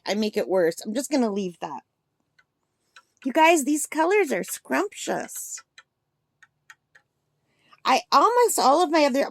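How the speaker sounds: ordinary talk, nothing marked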